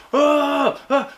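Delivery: high, loud mocking yell; imitating a honking driver's yell; mock angry yell. Mocking the driver who honks at him, mock yell.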